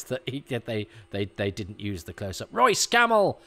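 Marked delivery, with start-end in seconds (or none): 2.5-3.4 high, loud cheer of a name; real excitement. Real, not acted. Fan spotting a favourite stuntman on screen, excited shout. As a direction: hail the stuntman like a delighted fan.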